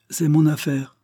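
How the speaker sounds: high, loud curt snap; blunt retort. A narrator's reading. Sophie curtly rebuffs Lester's question, loud and blunt. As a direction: slap him down with it. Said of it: "sans prendre la peine de déguiser sa pensée".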